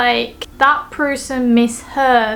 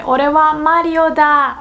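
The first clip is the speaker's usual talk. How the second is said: higher, slightly slowed anime-style claim; lightly voiced Wario; 4 semitones higher, 4 dB louder than usual. Wario claiming Mario's name, lightly hammed-up boast. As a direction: put on a mild impostor's boast. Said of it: "The Wario would say".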